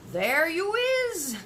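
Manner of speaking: the giant cries out, delighted; excited cry. A narrator's reading. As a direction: burst out in triumph. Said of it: "cried the B F G".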